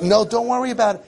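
high, brushing-off "don't worry"; mock dismissive parent. Parent brushing off a child's mistake, mock breezy and high. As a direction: airily wave the worry away.

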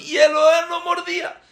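very high, shouted storytelling; dramatized high-pitched frenzy; frantic narration. A narrator's reading. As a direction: narrate the attack at screaming pitch. Narrates attack at screaming pitch.

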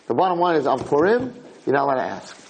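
normal talking voice

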